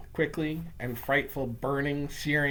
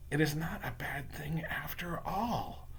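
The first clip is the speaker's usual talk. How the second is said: soft whisper to oneself; whispered thought; 9 dB quieter than usual. A narrator's reading. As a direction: breathe it as a private thought. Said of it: "I thought to myself".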